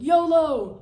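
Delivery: high, loud whoop; battle cry. Retold or imitated. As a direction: holler it as you charge. Re-enacts his battle cry.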